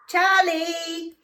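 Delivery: high, loud, drawn-out call. Real, not acted. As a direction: holler for the noisy cat. Calling to her deaf cat.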